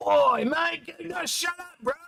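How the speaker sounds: high broad Aussie bogan snap; mock Australian yell, shut him up; angry Aussie. Angry Aussie on comms telling him off, broad Australian mock yell. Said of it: "an angry Aussie yell at you".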